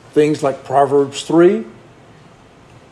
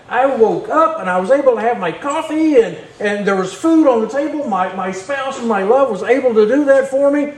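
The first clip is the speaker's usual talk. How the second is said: higher, bright rapid gushing praise; excited praise; 7 semitones higher than usual. Shown as a demonstration. Preacher models praising God to friends, excited. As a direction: gush about God like a favourite movie.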